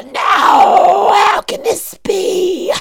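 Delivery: the demon shrieking as flames take him; anguished high monster shout; demon's dying cry. A narrator's reading. Demon burns.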